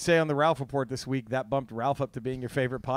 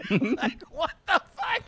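ordinary talk

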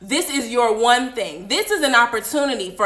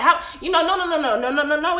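usual speaking voice

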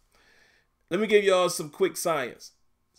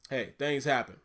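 ordinary delivery

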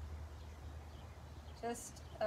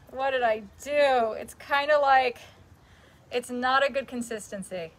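upset; 8 dB louder than usual. Acted. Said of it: "Ugh, I'm so upset right now".

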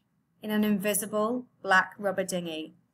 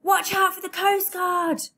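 loud, high shout over engine noise; shouting over machinery; 11 semitones higher, 5 dB louder than usual. Acted. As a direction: yell it over the roar of a motor. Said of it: "shouted over the noise".

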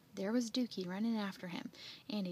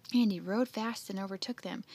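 usual speaking voice

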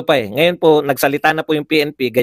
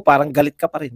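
ordinary delivery

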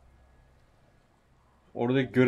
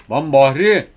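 angry yell at a teammate; shouting; 5 semitones higher, 13 dB louder, slower than usual. Real, not acted. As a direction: shout the name out loud. Yells at teammate shooting him.

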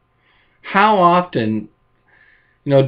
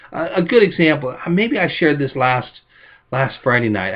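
ordinary talk, nothing marked

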